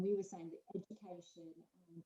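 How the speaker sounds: faint, dropping call audio; cutting out. Real, not acted. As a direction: voice fading out on a bad line. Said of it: "Oh, you've gone again".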